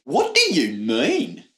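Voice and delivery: slow, drawn-out mock Aussie; puzzled cartoon Australian; bad Australian accent. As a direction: stretch it in a fake Australian twang. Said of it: "that is not what Australian people sound like".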